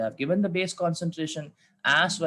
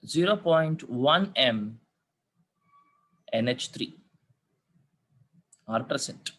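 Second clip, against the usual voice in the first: slow school dictation. Shown as a demonstration. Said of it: "I repeat the question".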